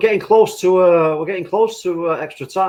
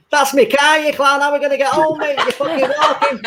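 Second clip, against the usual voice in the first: very high, indignant lout; tyre-slasher voice; 11 semitones higher, 4 dB louder than usual. Acted. Tyre slasher whose own car got hit, high indignant voice. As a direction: berate your idiot mate in a high put-on voice. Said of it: "What's that voice you did".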